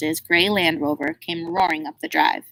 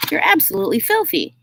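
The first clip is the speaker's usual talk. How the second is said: grandmother's shocked scolding; 10 semitones higher than usual. A narrator's reading.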